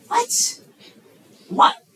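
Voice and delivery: high, shocked disbelief; stunned what. Retold or imitated. Young man stunned by girlfriend's breakup call, high disbelief. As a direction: yelp of disbelief at the news.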